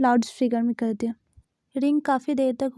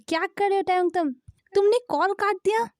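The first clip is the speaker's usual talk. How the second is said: angry; 7 semitones higher than usual. Acted. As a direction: pitch shoots up in outrage. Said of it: "बेक्योंग पूरा गुस्सा हो गया". (ऊँची आवाज़ में गुस्से से डाँटना)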